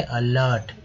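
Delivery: अलर्ट opening on "uh"; अलर्ट with a short first vowel; correct pronunciation. Shown as a demonstration. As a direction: demonstrate अलर्ट as "uh-laart". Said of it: "अलर्ट बोलेंगे".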